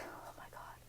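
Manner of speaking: breathless awed whisper. Real, not acted. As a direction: whisper it in awe. Excited reaction to restaurant.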